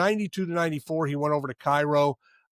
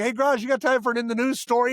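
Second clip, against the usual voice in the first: high-pitched, eager call to a co-host; retold on-air prompt; 9 semitones higher than usual. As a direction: playfully pitch it up to your partner. Retells cueing partner during game broadcast.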